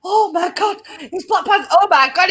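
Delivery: high-pitched gushing, laugh creeping in; fangirl excitement. Retold or imitated. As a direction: act out an overexcited film fan. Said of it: "find yourself sat there going".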